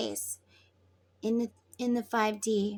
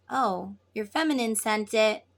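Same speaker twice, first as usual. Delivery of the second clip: ordinary delivery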